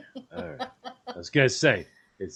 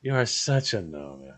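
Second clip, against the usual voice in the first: normal talking voice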